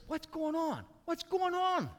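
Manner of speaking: high-pitched urgent questioning; acted high-voiced confusion; puzzled beggar. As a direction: ask the passing crowd in a high, anxious voice. Blind beggar asking about the crowd.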